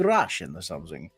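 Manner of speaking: low-pitched, rough try at a Russian accent. Shown as a demonstration. Streamer showing chat which accent he can do, low mock Russian accent. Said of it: "I could probably do something like that".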